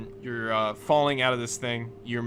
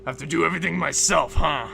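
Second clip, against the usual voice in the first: loud, higher-pitched fed-up complaint; groan, then grouse in a fast burst; exasperated grumble; 4 semitones higher, 9 dB louder than usual. Acted. Acting out exasperated Gotti on his turn.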